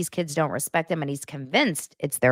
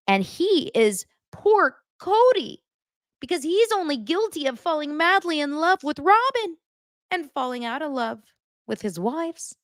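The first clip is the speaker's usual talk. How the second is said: high, mocking sympathy for poor Cody; sarcastic pity; 10 semitones higher than usual. Vlogger sneering at Cody's innocent act, high sarcastic voice. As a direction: lay the fake pity on thick, pitched up.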